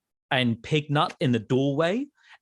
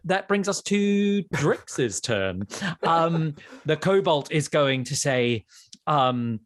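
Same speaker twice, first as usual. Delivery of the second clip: ordinary delivery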